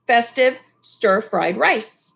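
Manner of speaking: usual speaking voice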